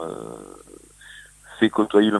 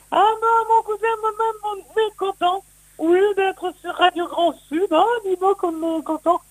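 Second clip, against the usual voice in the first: Charlot et Dino impression. Shown as a demonstration. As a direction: squeak it out like a cheerful cartoon pair. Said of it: "c'est Charlot et Dino".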